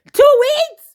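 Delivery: a high, loud, stunned "two weeks?"; incredulous squeal. Retold or imitated. Mocking a friend who fell in love in two weeks, squealing. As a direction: shriek the number in disbelief. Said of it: "And I said".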